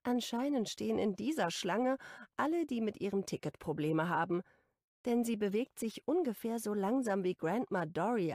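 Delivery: usual speaking voice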